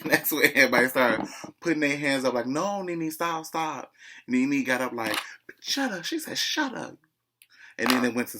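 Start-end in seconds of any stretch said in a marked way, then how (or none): 2.5-3.9 mock pleading. Imitates cast trying to stop Nene. 5.6-7.0 very high squawked shut up; high-pitched retold yell; mock outburst. Acted quarrel, Nene yelling.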